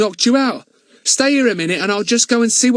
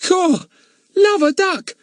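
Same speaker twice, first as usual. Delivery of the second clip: put-on excited boy; 13 semitones higher than usual. Ern finding the men in the coal cellar, excited. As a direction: blurt it out in excited wonder. Ern's excited Cockney lines.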